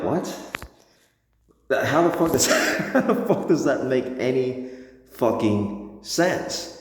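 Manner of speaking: high, laughing disbelief; can't believe how unfair it is; incredulous outrage. Real, not acted.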